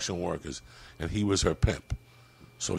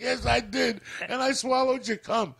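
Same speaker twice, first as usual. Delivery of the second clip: high mock drunk wife; 13 semitones higher than usual. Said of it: "She's like".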